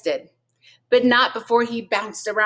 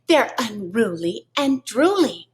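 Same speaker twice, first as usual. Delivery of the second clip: dramatic; 3 semitones higher than usual. A narrator's reading. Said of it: "she added dramatically".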